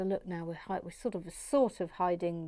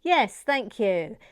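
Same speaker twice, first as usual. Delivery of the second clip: ordinary talk, nothing marked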